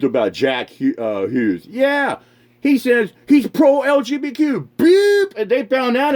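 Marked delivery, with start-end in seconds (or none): 4.8-5.3 mock censor bleep.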